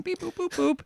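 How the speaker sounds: high dial tone beeps; high phone beeps. Retold or imitated. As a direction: chirp the dial tones. Imitating phone dialing.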